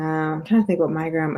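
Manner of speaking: usual speaking voice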